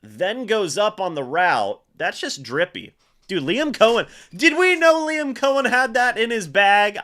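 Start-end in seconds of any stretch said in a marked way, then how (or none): 3.3-7.0 amazed. Real, not acted. Amazed at the coach's trick play.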